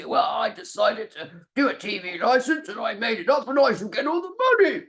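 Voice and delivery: mock the greedy aristocrat; pompous lord. Imagines a lord speaking.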